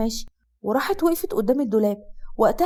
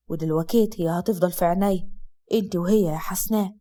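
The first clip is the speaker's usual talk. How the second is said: lowered, steady man's voice; Asad's low male voice; 6 semitones lower than usual. Acted. Husband comforting his sick wife, low male voice. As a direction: speak as the calm husband, low.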